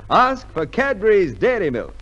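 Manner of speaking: normal talking voice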